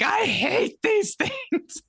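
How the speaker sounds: loud, high wail, hugely drawn out; hammed-up exasperated howl breaking into a laugh; mock loathing. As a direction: stretch every word in exaggerated, high-pitched hate. Acts out hatred of the shoes.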